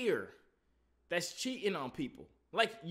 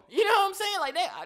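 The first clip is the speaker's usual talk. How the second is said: excited; 12 semitones higher, 8 dB louder than usual. Real, not acted. Excited rant about cheating.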